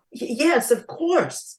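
high, loud, stammered assent; flustered eagerness. A narrator's reading. Prince Jack hurrying to help the princess, flustered eagerness. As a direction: agree hastily, tripping on the first word.

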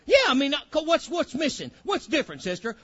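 loud, ringing pulpit preaching with high pitch; preaching at full force. Acted. Preacher rousing his congregation, loud full-force preaching. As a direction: preach it out to the whole church.

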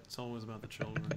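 low, soft, quick Rickman murmur; Alan Rickman impression. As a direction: drop low and hush it like Rickman. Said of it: "I think this one's".

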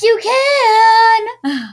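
slow drawn-out shout; loud shout. Acted. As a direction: bellow it slowly. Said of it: "Now I can hear you".

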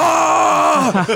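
high startled shriek, hammed-up; mock startled shriek. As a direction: squeal like a spooked monster. Frankenstein flinches at lighter.

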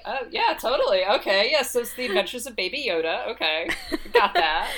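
high, laughing sarcastic yeah-sure; mock agreement. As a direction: play along sarcastically. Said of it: "it's just like".

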